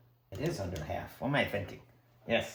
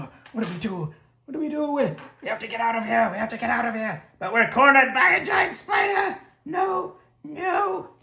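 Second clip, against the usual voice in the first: high, fast panic rising to shrieking; frightened party building to a scream; higher and louder panic, ending in shrieks; panicked characters; 11 semitones higher, 5 dB louder than usual. Acted. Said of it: "So now they're scared".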